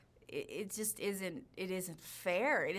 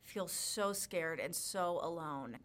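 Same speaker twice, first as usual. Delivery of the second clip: normal talking voice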